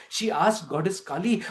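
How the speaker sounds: plain reading voice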